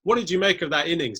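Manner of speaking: ordinary talk, nothing marked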